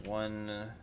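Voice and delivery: ordinary delivery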